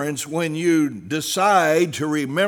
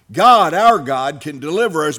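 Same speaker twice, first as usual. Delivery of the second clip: preaching at full force; 2 semitones lower, 4 dB louder than usual. Acted. Preacher repeating the youths' answer to the king, proclaiming. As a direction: build it big then let it drop.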